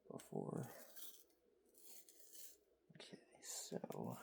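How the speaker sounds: soft close whisper; ASMR whisper. Real, not acted. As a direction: breathe the words, barely voiced. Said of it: "Not doing good in the A S M R voice".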